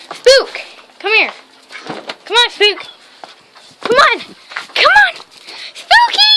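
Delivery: high, loud calls; calling the dog. Real, not acted.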